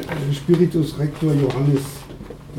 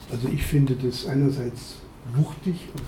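ordinary delivery

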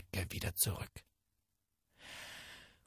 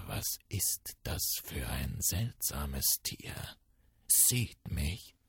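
hushed inner thought; 9 semitones lower, 6 dB quieter, slower than usual. A narrator's reading. Tiger denkt bei sich.

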